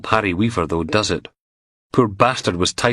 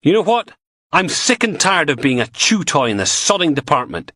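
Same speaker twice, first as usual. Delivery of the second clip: angry outburst; 11 semitones higher than usual. Acted. Logan blowing up at both bosses in anger. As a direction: finally blow your top.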